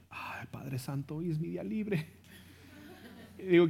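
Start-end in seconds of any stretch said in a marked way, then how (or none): 0.1-2.1 mock weary groan. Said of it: "yo me quedé".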